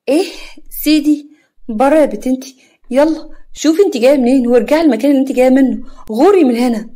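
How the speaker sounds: high, loud outraged yelling; furious shout. Acted. Yara shouting the drunk girl out of the house. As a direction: drive the intruder out with fury.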